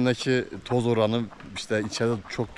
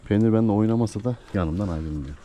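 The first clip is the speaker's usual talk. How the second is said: usual speaking voice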